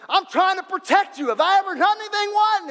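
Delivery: high, loud pleading donkey voice; talking donkey. Acted. Donkey pleading with the prophet beating it, high whine. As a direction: squeeze it up high like a hurt animal.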